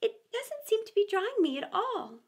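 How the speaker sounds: high, plaintive complaint; mildly melancholy. A narrator's reading. Alice complaining she is still wet, mildly mournful. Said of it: "in a melancholy tone".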